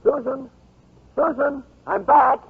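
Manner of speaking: high cheery calls; calling out. Acted. Mike calling Susan as he comes back, high cheery calls. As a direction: call out as you come in.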